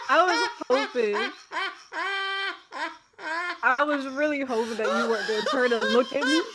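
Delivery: words through embarrassed giggles; real laughter while owning up to yawning; laughing. Real, not acted.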